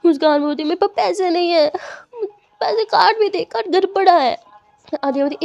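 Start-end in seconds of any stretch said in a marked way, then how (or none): 0.6-4.4 put-on higher, louder childish whine; higher, louder whine about the money; whiny upset. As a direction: complain like a sulking child.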